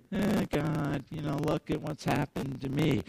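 soft far-off whine; whining. Retold or imitated. Elijah whining to God, soft and moping. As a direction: mope to God in a small whine. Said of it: "he's whining".

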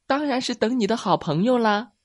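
very high, loud rabbit voice; eager little rabbit. Acted. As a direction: answer as if it were obvious. Rabbit answers mouse eagerly in story. (小兔子尖着嗓子抢答)

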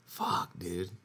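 ordinary talk

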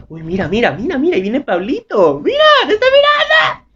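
put-on excited girls nudging each other; giggly gossip squeal. Girls spotting Pablito, squealing excitedly. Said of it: "las manes así".

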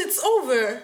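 retold disbelief. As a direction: exclaim it as if baffled. Retells own disbelief at app lasting.

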